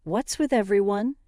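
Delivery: mildly flustered, pitch climbing on the second question; lightly voiced, rattled questioning. A narrator's reading. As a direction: ask with a touch of fluster. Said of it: "Emma started to get flustered".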